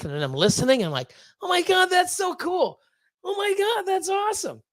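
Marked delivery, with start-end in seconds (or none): 1.4-4.6 high-pitched fan squeal in words; excited, very high reenactment; retold excited gushing. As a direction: gush like an amazed listener, voice way up. Acting out own excited reaction.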